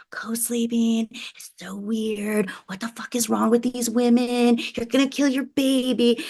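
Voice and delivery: hushed, stretched-out mock horror; mock disapproval. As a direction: imitate a judgmental busybody, soft and high. Mimicking her former judgmental self.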